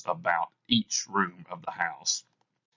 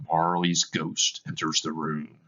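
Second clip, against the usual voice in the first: plain reading voice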